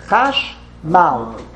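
Chash, pause, mal; two separate syllables. Shown as a demonstration. Teacher answering a student's syllable question, Chash and mal apart. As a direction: split Chashmal into two distinct beats. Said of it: "It's two syllables".

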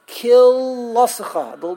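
KILL stretched loud, the rest run together; mock a botched, swallowed reading of KILL; swallowed word, mocked. Teacher imitating people who don't know, mock mangled word. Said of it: "they'll swallow the word in the most".

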